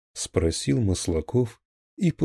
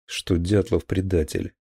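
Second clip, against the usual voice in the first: plain reading voice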